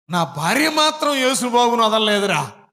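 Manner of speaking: father's boast voiced at full force; proud shout. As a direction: shout it out to the whole crowd. Father's testimony, shouted to crowd.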